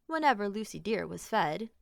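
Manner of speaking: usual speaking voice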